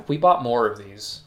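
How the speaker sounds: ordinary talk, nothing marked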